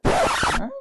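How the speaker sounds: squeaky high loud hmm; a shocked squeak of a hmm; very high surprised hmm; startled hmm. Retold or imitated. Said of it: "I said".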